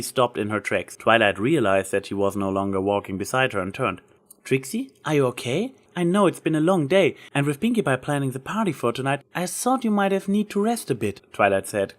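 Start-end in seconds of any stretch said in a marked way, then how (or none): 4.4-11.2 concerned. Acted. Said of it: "concern in her voice".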